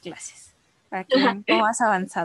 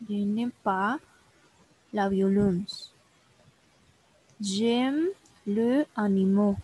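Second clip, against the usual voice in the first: slow, careful French; slow model reading; slower than usual. Shown as a demonstration. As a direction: say it slowly so the student can copy. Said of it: "teacher models French slowly".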